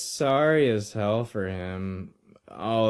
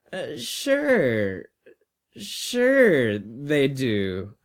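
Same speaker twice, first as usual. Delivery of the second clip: lightly voiced reassuring boy; 3 semitones higher, much slower than usual. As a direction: stretch it out to placate the old man.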